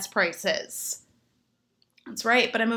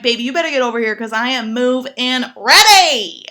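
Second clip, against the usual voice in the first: much louder eager invitation; excited shout; 10 dB louder than usual. Retold or imitated. To the gentleman, a loud overcome invitation. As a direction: holler it, overcome. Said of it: "I'm like".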